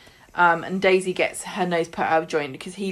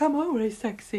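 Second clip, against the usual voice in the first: quieter, smug purr of a line; smug Daisy; 4 semitones higher, 5 dB quieter than usual. Retold or imitated. Daisy brushing off Jenny, softly smug. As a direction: play it vain and pleased with herself. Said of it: "And Daisy's like".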